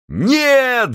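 high shouted no; chorus shout. Acted. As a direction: yell it as a chorus. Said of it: "закричали хором крестоносцы любви".

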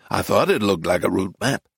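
low, satisfied tourist; American man. Acted. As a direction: confirm the hunch in a low American voice. American man confirms his idea.

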